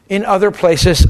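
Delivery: usual speaking voice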